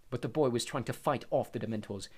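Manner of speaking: ordinary talk, nothing marked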